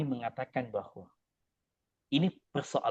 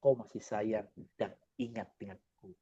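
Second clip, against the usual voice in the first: voicing Allah softly; 4 semitones lower, 6 dB quieter than usual. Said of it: "kata Allah".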